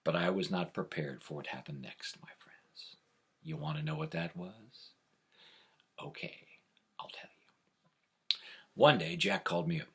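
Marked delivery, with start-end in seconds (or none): 0.0-8.4 suspense whisper. A narrator's reading. Teasing suspense for the audience.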